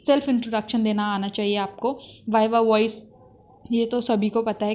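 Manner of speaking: ordinary talk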